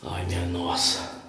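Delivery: soft, slow frightened breath of words; nervous night vlog whisper. Real, not acted. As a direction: barely voice it, spooked. Frightened hushed sigh. (sussurro assustado e lento)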